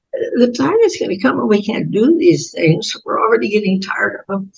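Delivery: usual speaking voice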